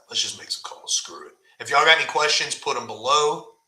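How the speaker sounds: usual speaking voice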